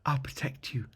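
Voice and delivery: soft whispered promise; whispering to the egg. Acted. Starmore whispering tenderly to the dragon egg. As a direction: hush it gently to the egg. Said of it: "Starmore told the egg".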